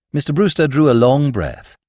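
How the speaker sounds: usual speaking voice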